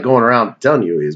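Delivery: ordinary talk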